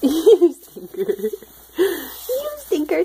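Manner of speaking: drawn-out playful tease. Real, not acted. Mother to baby.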